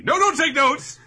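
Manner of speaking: high, loud indignant yell; mock outraged shout. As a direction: shriek it like he's offended. Imitating upset headphones guy.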